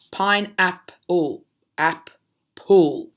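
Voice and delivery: Pineapple stretched out slowly so each part is heard; teacher stretching out Pineapple; slow, drawn-out word. Shown as a demonstration. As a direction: draw the word out for children. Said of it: "so syllables".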